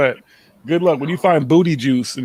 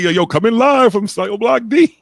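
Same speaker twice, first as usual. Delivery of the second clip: higher, excited jailhouse host parody; mock prison livestreamer; 7 semitones higher, 4 dB louder than usual. Teasing a friend's camera look, mock prison livestreamer. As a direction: call out a mock broadcast from prison. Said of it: "like he's in prison and shit".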